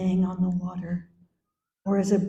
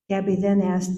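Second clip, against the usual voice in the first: normal talking voice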